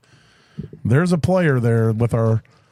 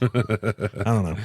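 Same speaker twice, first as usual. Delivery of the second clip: normal talking voice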